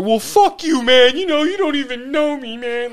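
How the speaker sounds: put-on loud, high, slurred belligerent drunk; drunk mouthing off. Drunk guy taunting a cop in the back seat, loud and high. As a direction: sneer it like a wasted guy picking a fight.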